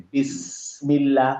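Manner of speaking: বিসমিল্লাহ with the mouth opened on বিস; very slowly stretch বিসমিল্লাহ, lips spread; open Seen. Shown as a demonstration. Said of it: "প্রসারিত দুই সাইডে".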